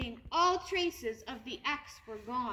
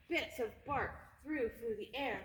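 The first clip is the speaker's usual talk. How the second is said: ordinary delivery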